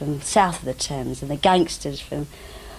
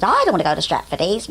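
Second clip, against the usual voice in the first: reluctant refusal; faster than usual. Retold or imitated. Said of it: "my immediate reaction was".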